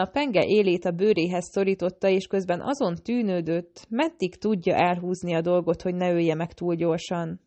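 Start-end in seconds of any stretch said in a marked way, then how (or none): none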